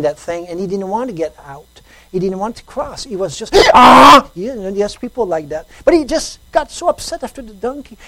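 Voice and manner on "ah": sudden loud high frustrated yell; angry yell. Retold or imitated. Man losing his temper at his donkey, angry yell. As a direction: blurt out a furious yell at the stubborn donkey.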